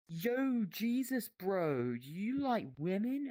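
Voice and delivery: high, jokey bro asking a buddy; mock high-pitched bro question. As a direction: pitch it way up like a hyped-up mate.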